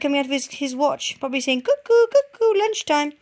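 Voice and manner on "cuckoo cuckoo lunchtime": high, loud clock-bird call; cuckoo call. Pretend. Clock bird calls lunchtime, high and loud.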